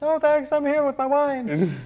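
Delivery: very high, slow fancy voice; posh Boris voice. Acted. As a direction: drawl it out sipping wine. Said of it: "Boris says".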